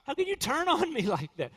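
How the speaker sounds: high, indignant mock protest. Sinner protesting to Satan, high and indignant. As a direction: squeak out a wounded objection. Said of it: "He's like".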